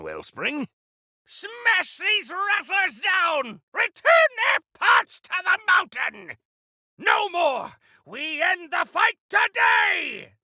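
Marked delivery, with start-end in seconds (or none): 1.2-10.3 loud, high, drawn-out war shout; fierce battle cry. Acted. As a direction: roar the charge at the enemy. Battle cries against robots.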